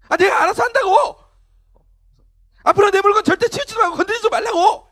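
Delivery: loud, high acted teen yell; angry retort. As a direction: shout back at a nagging parent. Acted teen yelling back at parent.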